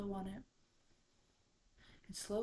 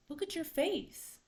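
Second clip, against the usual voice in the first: high, loud storybook shock; astonished exclamation; 8 semitones higher, 6 dB louder than usual. A narrator's reading. Everyone to Raccoon about his face, high astonished cry.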